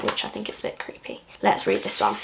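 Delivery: usual speaking voice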